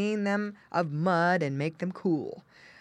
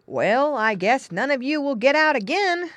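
louder, higher-pitched farmer voice; story farmer; 9 semitones higher, 8 dB louder than usual. Acted. Farmer to the pigs after boarding the hole, satisfied. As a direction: voice a farmer's firm declaration.

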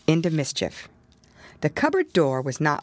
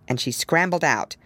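usual speaking voice

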